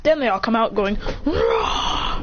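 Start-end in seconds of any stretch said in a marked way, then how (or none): 1.2-2.2 low growled roar; imitated angry roar. Tailed beasts all coming out furious, imitated roar. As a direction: roar like an enraged beast, without extra volume. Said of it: "Tailbeasts are pissed".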